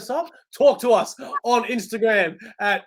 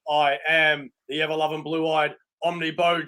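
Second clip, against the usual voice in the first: ordinary delivery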